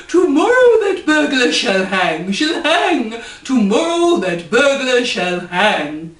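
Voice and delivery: loud, silly royal proclamation; hammed-up king decreeing a hanging. Sleepy old king announces the punishment in a silly voice. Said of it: "He's very silly voice too".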